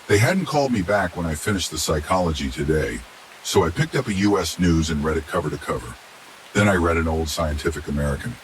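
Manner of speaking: usual speaking voice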